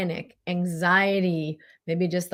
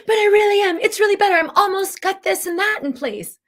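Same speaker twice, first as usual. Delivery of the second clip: much higher, louder, squeaky self-convinced voice; mock deluded insistence; 7 dB louder than usual. Mocking her own deluded self, high and loud. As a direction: caricature someone sure they are almost there.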